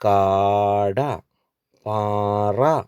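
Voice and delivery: കാട, പാറ with long, held aa; long-vowel reading drill. Shown as a demonstration. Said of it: "ഇതുകൊണ്ട് വരുന്ന വാക്കുകൾ". (കാട, പാറ ദീർഘം നീട്ടി വായിക്കുക)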